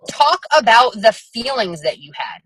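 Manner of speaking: ordinary delivery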